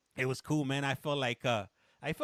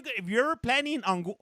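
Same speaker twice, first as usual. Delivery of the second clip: normal talking voice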